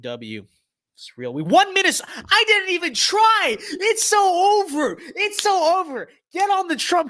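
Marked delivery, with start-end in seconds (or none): 1.3-3.6 squealing with glee; giddy disbelief. Real, not acted. Disbelieving Minnesota win in game. 3.6-5.0 high drawn squeal. Real, not acted. Repeated victory phrase. 5.0-7.1 squealing with glee; giddy disbelief. Real, not acted. Disbelieving Minnesota win in game.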